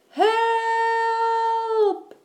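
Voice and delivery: very high, long-held cry; shout for help. A narrator's reading. Eddie trapped by the bear, shouting for rescue. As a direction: wail it out, stretched long. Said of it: "shouted Eddie".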